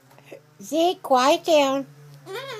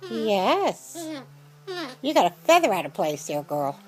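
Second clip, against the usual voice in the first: ordinary delivery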